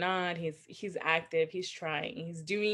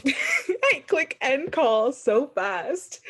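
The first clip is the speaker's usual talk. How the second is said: laughing; 7 semitones higher, 6 dB louder, much slower than usual. Real, not acted. Laughing at own clumsy phone mistake.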